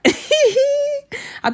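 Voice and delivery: high slow words through laughter; laughing squeal. Real, not acted. Laughing at Ravi's failed join, high giggly squeal.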